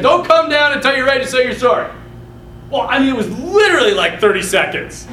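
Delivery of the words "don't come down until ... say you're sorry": stern scolding. Retold or imitated. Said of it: "And he's like".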